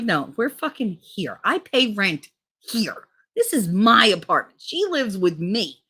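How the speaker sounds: indignant insistence. Retold or imitated. Boyfriend insisting it's his apartment, mock indignant. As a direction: lay down each point slowly and firmly.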